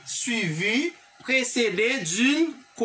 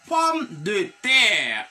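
drawn out slowly; 2 semitones lower, much slower than usual. Shown as a demonstration. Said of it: "teacher stretches key word".

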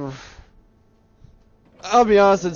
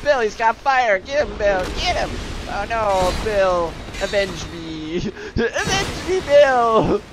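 hammed-up dying enemy calling for help; high, laughing cries of a dying goon; overacted death scene; 9 semitones higher, 4 dB louder than usual. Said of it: "It's like".

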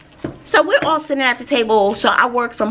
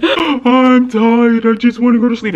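whiny, louder imitation of a crying daughter; imitating crying teen; 2 semitones higher, 5 dB louder than usual. Mother imitating her crying daughter, whiny and louder. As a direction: mimic a tired, tearful teen. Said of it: "She starts crying".

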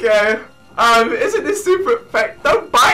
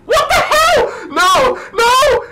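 real excited screaming. Real, not acted. Gamer screaming at a Crobat on roids, shrieking with laughter. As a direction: lose it completely at the game. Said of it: "I'm losing it!".

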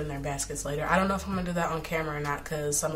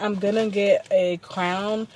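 ordinary talk

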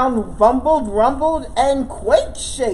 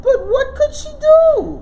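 squeaky voice; 7 dB louder than usual. Acted. Narrator slips into mouse.

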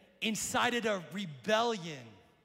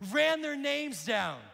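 ordinary delivery

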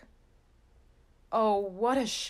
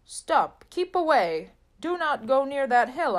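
loud, higher urgent shout; shouted warning; 6 semitones higher, 5 dB louder than usual. A narrator's reading. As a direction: yell to stop them from a distance. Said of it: "A man said".

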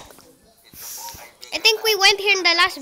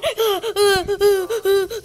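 nervous laughter; much slower than usual. Real, not acted. Laugh after the scare.

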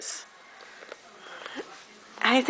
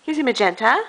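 ordinary talk

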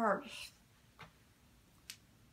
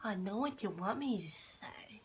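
whisper; 3 semitones lower, 12 dB quieter than usual. Real, not acted. Groggy patient whispering.

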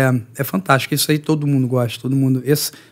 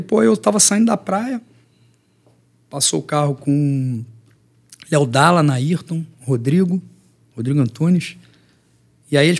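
ordinary delivery

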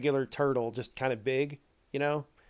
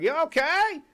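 much higher, concerned call; 14 semitones higher, 5 dB louder than usual. Retold or imitated. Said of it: "He's like".

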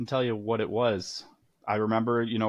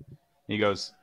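ordinary talk, nothing marked

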